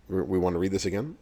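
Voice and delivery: ordinary talk, nothing marked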